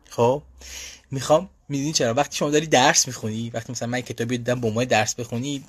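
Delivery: normal talking voice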